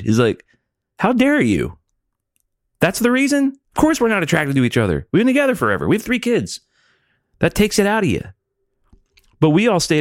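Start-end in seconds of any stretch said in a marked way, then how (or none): 1.0-8.2 high, affronted protest; indignant outrage. Retold or imitated. Husband protesting to his wife, indignant and high. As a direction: protest the reason, appalled. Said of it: "He was, like, so offended".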